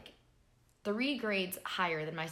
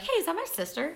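surprised; 10 semitones higher, 4 dB louder, faster than usual. Retold or imitated. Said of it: "and I'm like".

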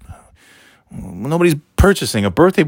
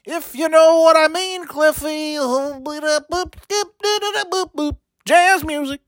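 put-on much higher, sly party-boy voice; high-voiced schemer; 12 semitones higher than usual. Bragging to Cliffy about drink passes and ladies, very high sly voice. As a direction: crank the pitch way up and brag slyly.

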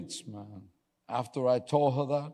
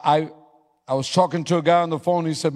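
ordinary delivery

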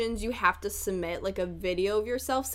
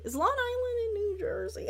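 amused doubt; 11 semitones higher, 3 dB quieter than usual. Real, not acted. Laughing at own confusion.

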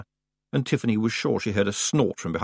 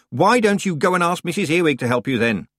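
louder than intended; 8 semitones higher, 5 dB louder than usual. A narrator's reading. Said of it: "come out a bit louder than intended".

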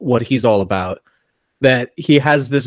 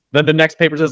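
usual speaking voice

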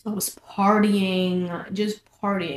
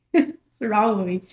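normal talking voice

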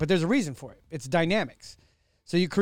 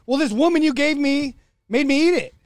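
higher, louder whiny excuse; Adam shifting blame in a higher whine; 9 semitones higher, 8 dB louder than usual. Retold or imitated. Adam blames Eve before God, higher whining excuse. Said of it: "He's like".

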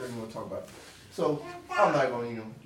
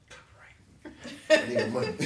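laughing joke; 3 dB louder than usual. Real, not acted. Joking about copyright.

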